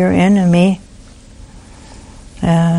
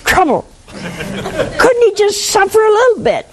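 high, huffy mock complaint that ends in a laugh; indignant widow; 10 semitones higher than usual. Nun imitating the angry widow at her husband's deathbed conversion, high and mocking. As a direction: mimic a resentful wife much higher, hammed up. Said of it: "And she was so mad".

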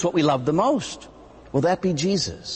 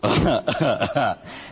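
amused chuckle; real laugh; short laugh; 5 semitones lower than usual. Real, not acted. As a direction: laugh at the answer. Laughs at kids' answer.

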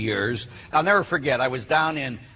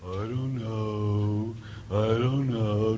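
put-on very slow, low, droning teen; mock dim monotone, drawn way out; 4 semitones lower, 4 dB quieter, much slower than usual. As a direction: imitate a dopey teenager dragging every word. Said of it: "some of you are the same thing".

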